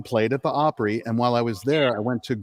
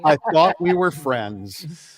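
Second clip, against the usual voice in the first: mock hurt; 5 semitones higher, 4 dB louder, much slower than usual. Mock hurt after the jab.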